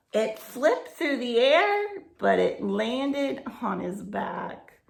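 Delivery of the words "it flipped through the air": ordinary delivery, nothing marked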